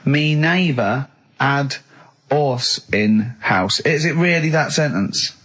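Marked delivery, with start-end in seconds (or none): 0.0-3.8 drawn-out, deadpan reading of the phrase; slow mocking recital. [name] mocking the phrase on air, slow deadpan recital. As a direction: spell it out slowly as if it's nonsense.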